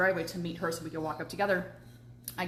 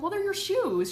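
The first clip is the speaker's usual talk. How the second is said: incredulous retort; 12 semitones higher, 3 dB louder than usual. Retold or imitated. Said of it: "I'm like".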